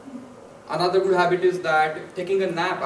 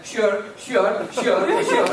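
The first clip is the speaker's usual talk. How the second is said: rising, louder sure, sure, getting higher; bewildered man humoring him; baffled passerby; 6 semitones higher, 8 dB louder, much slower than usual. Retold or imitated. Passerby answers his repeated excuse me, baffled and louder. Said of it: "And this guy".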